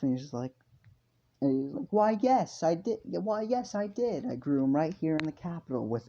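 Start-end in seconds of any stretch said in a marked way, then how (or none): 1.9-6.1 high, eager merchant voice; put-on salesman brightness, pitched up; proud market seller. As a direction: boast like a stallholder selling his wares. Seller proudly answers the customer.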